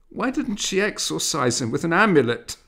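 a scared gossiping matron; frightened question. Acted. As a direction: ask in alarm, voice up high. Frightened woman asks.

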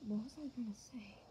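hushed whisper to himself; muttered whispered question. Real, not acted. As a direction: whisper it under the breath. Whispered aside.

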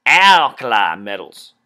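"alkali" drawn out with a twangy, nasal end. Shown as a demonstration. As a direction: stretch "alkali" slow and twangy. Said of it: "Gotta get really twangy there".

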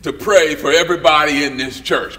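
hammed-up preacher at the pulpit, calling out loud. Preacher voiced in church story.

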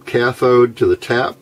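usual speaking voice

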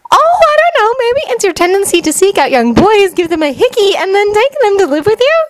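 high-pitched sarcastic speculation; mock-pondering, high and cutting. A narrator's reading. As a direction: pile on sarcasm in a high girl voice. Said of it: "Sakura suggested sarcastically".

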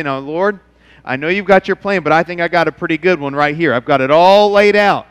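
normal talking voice

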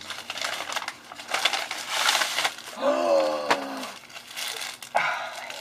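long breathless gasp; awed gasp. Real, not acted. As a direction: gasp at the surprise. Opening gift.

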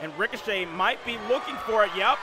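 excited live play-by-play call; wrestling commentator. Acted. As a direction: call the match like a ringside announcer.

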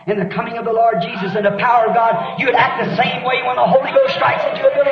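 rising into a full preaching shout; building preacher shout. Acted. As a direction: let it swell until you're shouting the last line.

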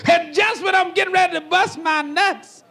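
ordinary talk

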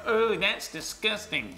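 high, drawn-out mock American disgust; badly faked American, grossed out; hammed-up American recoiling in disgust; terrible American accent. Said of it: "That's a terrible American accent".

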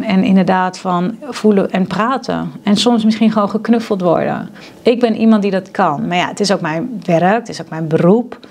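ordinary talk, nothing marked

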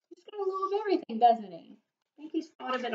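fast, high, soft aside; quick aside. A narrator's reading. Narrator chatting to the child, quick high aside. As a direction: rattle it off to the little listener. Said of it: "aside to child listener".

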